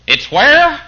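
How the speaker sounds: mock disbelief at a kingdom within; incredulous. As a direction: jump up in pitch as if you misheard. Preacher voices incredulous believer.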